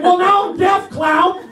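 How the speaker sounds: high-pitched exasperated comeback; imitated annoyed sergeant. Sergeant answering the trainee who yelled, mock exasperation. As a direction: put the trainee down like a fed-up sergeant.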